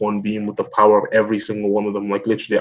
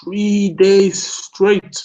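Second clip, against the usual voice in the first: THREE DAYS STRAIGHT, high and very slow; drawn-out disbelief; 8 semitones higher, much slower than usual. Real, not acted. Commentator marvels at the hunting method, "Three days straight" drawn out in disbelief. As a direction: stretch each word of "Three days straight", pitched up. Repeats shocking detail in disbelief.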